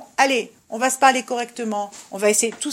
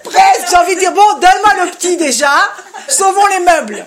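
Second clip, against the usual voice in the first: loud and laughing, exasperated amusement; laughing loudly; 5 semitones higher, 8 dB louder than usual. Real, not acted. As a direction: laugh it out, half despairing. Laughing retelling. (éclat de rire exaspéré)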